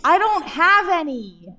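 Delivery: loud, slow, defiant snap; angry retort. Acted. Intern quarrels with the doctor.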